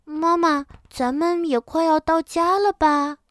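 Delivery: put-on high, childlike cub voice; little one's high, hopeful question; sleepy little bear. A narrator's reading. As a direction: ask Mama like a tired child. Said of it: "它有点困了".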